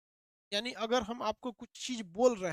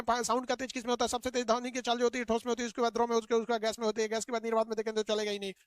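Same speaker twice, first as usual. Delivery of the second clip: fast, rushed lecture; too-fast teaching; faster than usual. Shown as a demonstration. Teacher showing how a lesson rushed too fast sounds. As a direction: rattle through the lesson without pauses. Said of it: "बहुत तेज पाठ पढ़ाने लगेंगे".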